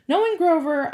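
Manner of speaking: usual speaking voice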